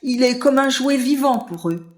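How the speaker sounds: ordinary delivery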